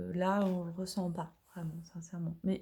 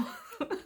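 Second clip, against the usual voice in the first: breathy little laugh at oneself; short laugh; 6 dB quieter than usual. Real, not acted. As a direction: laugh briefly at your own accent. Laughs at own accent attempt.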